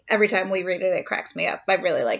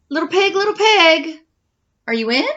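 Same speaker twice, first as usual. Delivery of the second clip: calling little pig; 6 semitones higher, 7 dB louder than usual. Acted. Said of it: "so I said".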